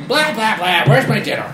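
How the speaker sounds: high, loud grumbling demand; grumpy husband. Retold or imitated. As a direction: whine it like a cranky old man. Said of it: "And you".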